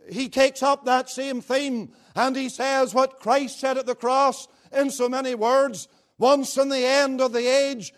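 high, ringing pulpit delivery; full-force preaching. Real, not acted. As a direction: press each phrase on the congregation, swelling at the end.

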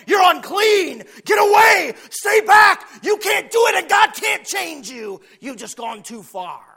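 mock preacher rant building to a high shout; condemning preacher. Preacher imitating another's condemnation of sinners, building to a shout. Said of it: "I heard a preacher preaching that stuff".

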